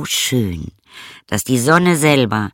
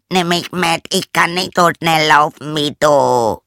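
raised higher frog plea; frog calling out; 6 semitones higher, 4 dB louder than usual. Acted. Frog shouting after the princess to take him along. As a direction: call after her, pleading to be taken along. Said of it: "rief der Frosch".

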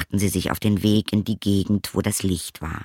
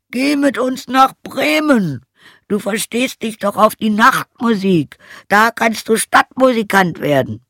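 high, loud donkey voice; rallying donkey character; 7 dB louder than usual. Acted. Donkey invites the old hound to make music, rallying donkey voice. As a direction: call out the plan high and strong.

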